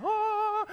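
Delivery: mock angel choir "Ah!"; a hammed-up choir of angels; angelic choir note. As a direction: sing it high and loud like a heavenly chorus. Angels sing as clouds part.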